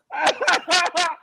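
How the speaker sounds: a big real laugh; loud amused laugh; loud burst of laughter. Real, not acted. Laughs at Moreno.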